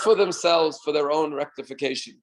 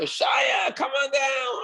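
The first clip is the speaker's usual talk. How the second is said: high shouted call across the field; 11 semitones higher, much slower than usual. Retold or imitated. Ballplayers calling Shaya down, high shouted call. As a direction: yell to a kid in the stands.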